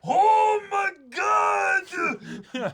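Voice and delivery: very high, very loud, slow hammed-up anime cry; Joseph Joestar. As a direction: stretch it out like a dramatic anime hero. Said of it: "Say it like Joseph Joestar".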